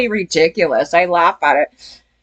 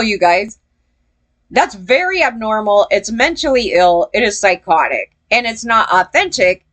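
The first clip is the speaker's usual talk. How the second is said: ordinary delivery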